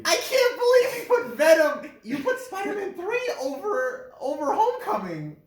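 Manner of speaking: can't believe the friend's ranking, shouting and laughing; incredulous outburst. Real, not acted. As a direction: blurt it out laughing in shock. Continues disbelief at ranking.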